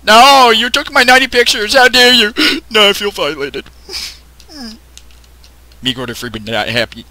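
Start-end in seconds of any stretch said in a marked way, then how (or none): 0.0-3.6 high loud hammed-up indignation; mock outrage. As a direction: melodramatic offended diva.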